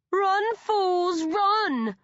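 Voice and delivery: high loud cackling taunt. A narrator's reading. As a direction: cackle it like a jeering villain. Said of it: "another of the boys cackles".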